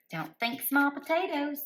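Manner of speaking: high, put-on voice for spirit's words; mock spirit voice, high and bright-eyed; quoting spirit's voice. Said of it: "That's how they said it too".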